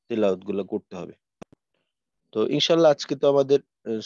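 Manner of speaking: ordinary talk, nothing marked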